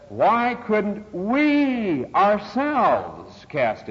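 WE and OURSELVES very drawn out and high; very slow, heavy emphasis on WE ourselves; stress on WE. Shown as a demonstration. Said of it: "is very emphatic".

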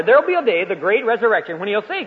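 ordinary talk, nothing marked

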